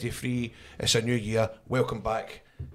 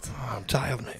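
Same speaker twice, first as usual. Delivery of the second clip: whispered, weary complaint; tired whisper; 7 dB quieter, slower than usual. Pretend. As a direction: breathe out the words, worn out.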